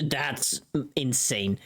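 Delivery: ordinary delivery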